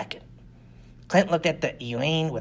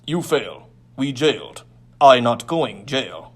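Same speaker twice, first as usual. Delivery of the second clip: broken words, getting louder, slower at the end; alien thug; 2 semitones lower, 7 dB louder, slower than usual. Acted. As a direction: push back in broken speech, growing louder. Alien thug fears jail.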